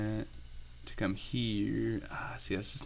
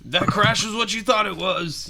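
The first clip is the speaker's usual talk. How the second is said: high, raised-voice call to companions; yelling back; 10 semitones higher, 6 dB louder than usual. Acted. Player's character turns back to friends, yelling. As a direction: call it out loud and high to the party. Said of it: "and just yell".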